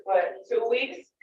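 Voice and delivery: normal talking voice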